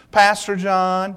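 lightly voiced kid, slow and drawn out; ten-year-old camper. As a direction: stretch the words like an earnest boy. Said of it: "now this is how he talked".

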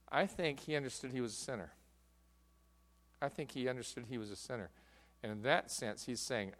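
ordinary talk

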